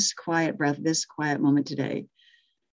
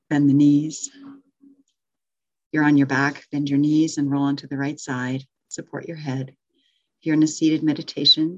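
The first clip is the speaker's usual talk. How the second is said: guided meditation. Acted. Teacher guiding the virtual circle out of Shavasana, calm meditation voice. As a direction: ease the class gently out of stillness.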